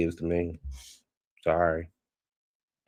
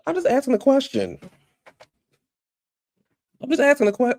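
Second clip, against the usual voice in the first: much higher, faux-innocent falsetto; mock innocent squeak. Teasing her viewers with a squeaky faux-innocent voice. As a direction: play dumb in a tiny high voice.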